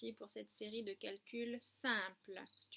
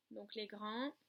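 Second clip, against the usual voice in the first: ordinary talk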